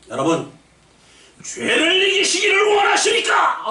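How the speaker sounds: slow, high shouting revivalist call; revival preacher. Retold or imitated. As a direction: imitate a fiery revival preacher working the crowd. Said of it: "아, 부흥사 목사님이". (부흥사 흉내, 느리고 높게 외치기)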